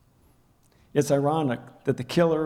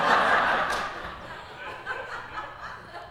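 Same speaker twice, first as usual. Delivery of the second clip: long, airy laugh that fades out; real laugh; 4 dB quieter than usual. Real, not acted. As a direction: laugh at how it turned out. Laughs at the memory.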